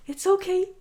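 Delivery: imitating a friend's high-pitched soothing, laugh breaking in; high, teasing comfort on the edge of laughter; mock reassurance. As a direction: parody a friend soothing someone, pitched right up. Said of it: "every single time you reassure me".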